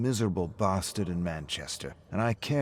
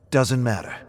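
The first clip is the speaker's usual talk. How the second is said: firm dismissal; 4 semitones higher, 7 dB louder than usual. Acted. David repeats, shutting the topic.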